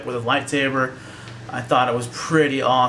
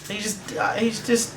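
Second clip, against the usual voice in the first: higher, pitched-up insistence; heated defense; 9 semitones higher than usual. Real, not acted. Defending Chewbacca's grief to Chris, pitched up. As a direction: plead for Chewbacca's loss. Upset about Chewbacca's friend.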